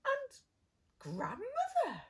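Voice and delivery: put-on very high, loud little girl; wondering girl. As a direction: marvel like a small child. Girl's famous line.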